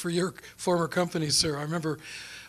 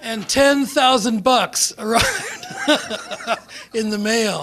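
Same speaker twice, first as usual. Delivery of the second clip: laughing through the words, slow and louder; higher and loud, laughing as he tells it; 8 semitones higher, 7 dB louder, slower than usual. Real, not acted. Laughing at his own windfall.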